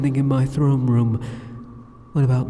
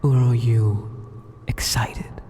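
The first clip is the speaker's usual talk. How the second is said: a single breathy whispered word; teasing whisper; slower than usual. Acted. Demon lord teasing the hero's reaction, whispering. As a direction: tease him with one hushed word. Taunting hero.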